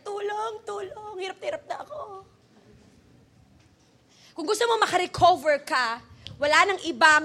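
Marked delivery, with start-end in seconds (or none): 0.0-2.0 high, soft cry for help; acted tearful whimper; suffering soul. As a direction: plead weakly, as if worn out. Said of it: "kung nagsasalita yan, sasabi niya".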